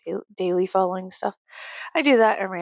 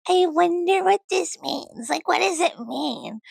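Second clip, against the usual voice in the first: high, slow mock-wondering voice; mock pondering; 9 semitones higher, slower than usual. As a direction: wonder aloud in exaggerated high singsong. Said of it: "talk to him about and be like".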